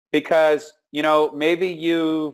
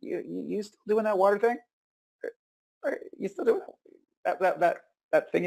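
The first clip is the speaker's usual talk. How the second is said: higher and softer, a tentative asking voice; imitating a curious friend, higher and quieter; curious prospect; 7 semitones higher, 6 dB quieter than usual. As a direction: sheepishly ask if you're still doing it. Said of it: "they're gonna come back and they're gonna".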